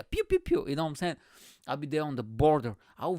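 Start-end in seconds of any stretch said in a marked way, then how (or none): 0.0-0.6 very high-pitched pew sounds; playful toy-gun shooting noises; squirt gun noises. Pretend. Pretend border guard firing a squirt gun, squeaky pews.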